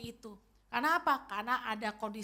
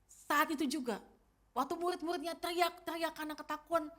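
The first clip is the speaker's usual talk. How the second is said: ordinary talk, nothing marked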